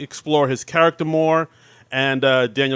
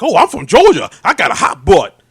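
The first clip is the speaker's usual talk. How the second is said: put-on Georgia accent; 4 semitones higher, 6 dB louder than usual. Podcaster quoting the sketch's dialect coach, mock Georgia accent. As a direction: mimic an overdone Southern dialect coach from Georgia. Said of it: "like, a Georgia accent?".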